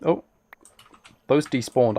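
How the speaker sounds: ordinary talk, nothing marked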